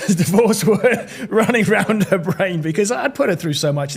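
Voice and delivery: amused, laughter bubbling under the words; laughing through it. Real, not acted. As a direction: let the laugh break up the words. Laughs telling of divorce worry.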